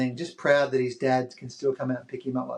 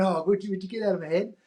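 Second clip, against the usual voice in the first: quick, higher teasing complaint; fast mock protest, pitched up; mock exasperation; 5 semitones higher, faster than usual. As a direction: playfully shoo him out of your head. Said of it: "I went".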